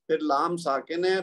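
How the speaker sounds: ordinary delivery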